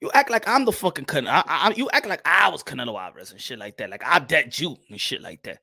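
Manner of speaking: ordinary talk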